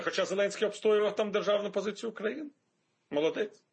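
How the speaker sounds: usual speaking voice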